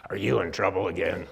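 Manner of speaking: low, gravelly charge nurse growl; gravelly old nurse. Retold or imitated. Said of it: "this gravelly old charge nurse".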